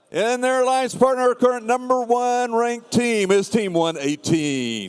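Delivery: high, hyped-up arena announcer. Acted. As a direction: pump up the crowd announcing teams. Hypes the match line-up.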